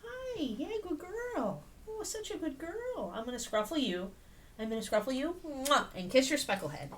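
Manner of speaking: high sing-song cooing at a puppy. Real, not acted. Owner coos high to her good girl.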